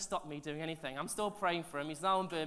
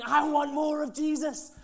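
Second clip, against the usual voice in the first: slow, high, loud plea for more; hungry and pleading, drawn out; eager plea; 8 semitones higher, 10 dB louder, slower than usual. Retold or imitated. Young believer across the coffee table, eager pleading. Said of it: "over the coffee table to me going".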